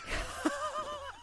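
high-pitched burst of laughter. Real, not acted. Laughing at quoted bit.

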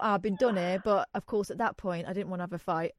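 ordinary talk

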